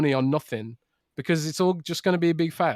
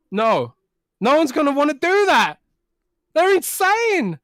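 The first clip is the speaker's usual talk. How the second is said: loud, high-pitched incredulous rant; real exasperation, pitched way up; exasperated outburst; 11 semitones higher, 5 dB louder than usual. Real, not acted. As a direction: raise your voice in disbelief. Heated rant against VR meetings.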